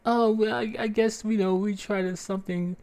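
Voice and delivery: a doctor without answers; mock doctor. Doctor's lame excuse.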